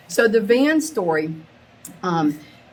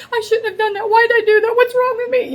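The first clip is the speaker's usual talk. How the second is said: high, loud, frantic self-blame; full-blown retold panic; 6 dB louder, faster than usual. Mom in full-blown panic, scolding herself, high and frantic. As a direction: spiral into a panic attack. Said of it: "this full blown".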